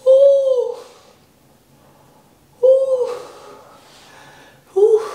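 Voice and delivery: high, slow, shaking from cold; shivering. Real, not acted. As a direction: drag the words while freezing. After cold shower.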